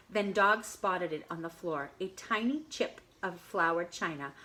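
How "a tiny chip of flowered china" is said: plain reading voice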